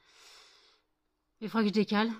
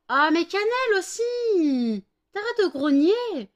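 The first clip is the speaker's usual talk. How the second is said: high, loud, slow scolding of the dog; exasperated dog owner shouting; 10 semitones higher, 9 dB louder, slower than usual. Real, not acted. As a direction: yell at the growling dog to stop. Scolding the dogs.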